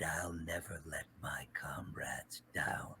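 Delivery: slow, soft bitter whisper. Acted. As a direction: hiss the quote under breath. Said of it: "to get Obito".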